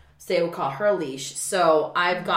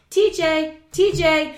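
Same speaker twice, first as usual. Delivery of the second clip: grandmother calling; 10 semitones higher, 6 dB louder, slower than usual. Retold or imitated. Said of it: "video of her going".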